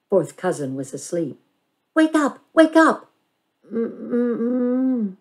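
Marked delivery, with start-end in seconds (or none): 1.9-3.1 much higher and louder; urgent wake up. A narrator's reading. Bandit shakes her sleeping cousin, calling loudly. 3.7-5.2 sleepy groan. A narrator's reading.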